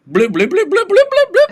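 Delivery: high, loud rapid bloops; mock siren bleeps. Man charging up to cart-leavers, high mock siren. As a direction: imitate a silly alarm with your voice. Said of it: "runs up to them and goes".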